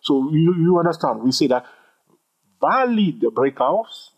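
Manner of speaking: normal talking voice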